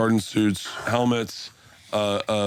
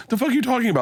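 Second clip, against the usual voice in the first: mock confused reply; 10 semitones higher, much faster than usual. Bert retells his own confused reply.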